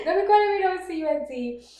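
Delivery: hammed-up telling-off; retold livid. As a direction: play offended through laughter. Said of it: "fucking livid".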